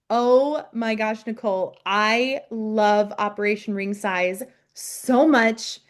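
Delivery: ordinary delivery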